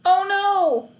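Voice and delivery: high, loud dismayed cry; old neighbour exclaiming in shock; exclaimed. A narrator's reading. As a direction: gasp it out in dismay. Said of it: "exclaimed Missus McCluskey".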